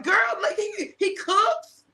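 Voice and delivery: hyping up her ex to a friend, laughing; selling him. Retold or imitated. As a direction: pitch him up high like a hype girl. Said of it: "I'm like".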